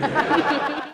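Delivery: high, loud, mock Lurch-like guffaw; hammed-up monster laugh; imitating a big friend's laugh. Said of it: "He laughed like Lurch".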